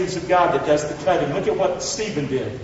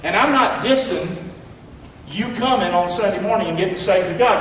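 ordinary delivery

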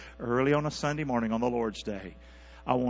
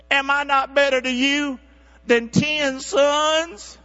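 very high, loud mock-pleading husband; hammed-up, slow self-important question held up high; Elkanah; 11 semitones higher, 7 dB louder, slower than usual. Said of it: "her husband, Elkanah, said to her".

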